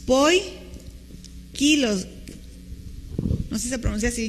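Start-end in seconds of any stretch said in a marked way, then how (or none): 0.1-2.1 "Poikilos" in two slow pieces, poi... KI-los; stress on the I. Shown as a demonstration. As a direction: break "Poikilos" after poi, then stress KI. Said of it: "lleva acento en la I". ("Poikilos" muy lento, pausa tras poi y acento en KI)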